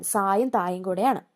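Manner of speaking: normal talking voice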